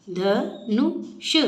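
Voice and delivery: Dhanush spelled out slowly, each syllable clear. Shown as a demonstration. Teacher sounding out Dhanush slowly for children. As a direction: stretch Dhanush into separate beats. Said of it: "ऊ का sound सुनाई देना चाहिए".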